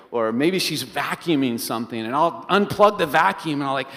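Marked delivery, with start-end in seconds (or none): none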